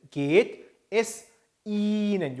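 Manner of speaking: geht with a long drawn e, es Ihnen very slow; long e sound. Shown as a demonstration. Teacher demonstrating long e in geht, slowly. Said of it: "에 길게 되니까".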